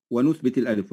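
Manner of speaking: ordinary delivery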